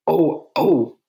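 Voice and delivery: high, startled "Oh, oh"; shocked gasp. Retold or imitated. Medium stepping back from the kiss, shocked. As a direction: caught out by what she just did. Shocked after kissing stranger.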